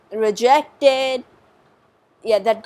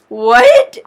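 shocked shout; 14 semitones higher, 12 dB louder than usual. Real, not acted. Baffled by sister's cotton advice.

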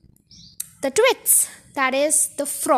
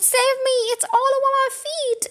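much higher, frantic cries; terrified screaming; 10 semitones higher than usual. Acted. Mrs Twit begging her husband, high terrified cries. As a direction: plead in terror as it crawls. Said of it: "screamed Missus Twit".

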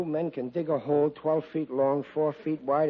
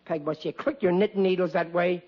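ordinary talk

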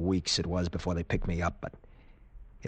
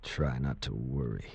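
bitter echo; 8 semitones lower, 7 dB quieter than usual. Acted. Patient mockingly echoes doctor's words.

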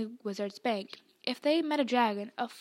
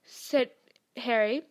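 plain reading voice

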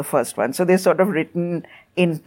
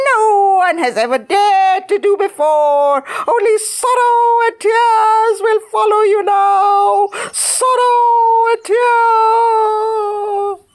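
wailing witch curse; 5 dB louder, slower than usual. A narrator's reading.

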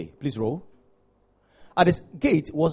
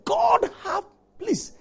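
retold cry; much slower than usual. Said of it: "the man shouted".

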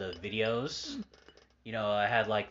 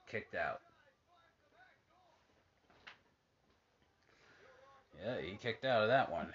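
casual ringside play-by-play, hushed between moves; wrestling commentator; 3 semitones higher than usual. Acted. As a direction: call each move as it lands. Calling the match on TV.

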